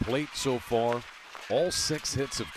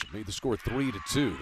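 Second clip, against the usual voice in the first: normal talking voice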